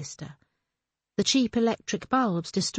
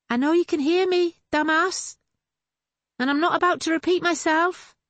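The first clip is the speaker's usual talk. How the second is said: raised much higher and louder; calling after him; 10 semitones higher, 5 dB louder than usual. A narrator's reading. Sister calls after her walking-away brother, loud and high. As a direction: shout it after him, half teasing. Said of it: "Her voice reached him".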